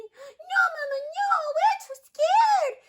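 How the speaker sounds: high, loud squealing refusal, stretched at the end; scared ducklings. A narrator's reading. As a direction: wail to Mama like frightened little ducklings. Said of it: "said Waddle, Piddle, Puddle".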